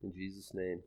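prayer. Acted. Closing prayer.

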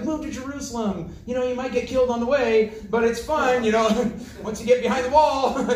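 high, breezy mock sales pitch; travel agent pitch. Travel agent selling a move to Jerusalem, mock-cheery pitch. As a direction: sell a dangerous place as if it were fun. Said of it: "you know, travel agents".

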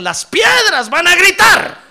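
loud, high, slow fiery preaching; preaching at full force. Acted. Preacher quoting the Lord, stones shouting, loud. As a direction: shout it over the congregation.

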